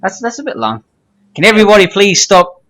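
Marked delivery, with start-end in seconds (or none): 1.4-2.6 a light put-on Samoan accent. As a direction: do the line in a hammed Samoan accent. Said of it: "Do it in a Samoan accent".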